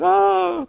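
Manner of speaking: a high, drawn-out mock wail; mock crying wail. Mordecai weeping outside the palace gate, mock wail. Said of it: "sitting there, super crying".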